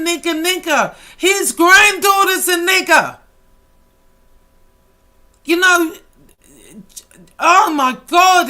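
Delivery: loud, high, heated yelling; angry outburst. Real, not acted. Ranting on YouTube at a critic over slurs, angry shout. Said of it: "let me calm down".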